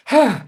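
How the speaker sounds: sudden high, loud "huh"; sharp mock-frantic gasp; startled gasp. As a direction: burst in with a comic gasp. Slides into room like Kramer.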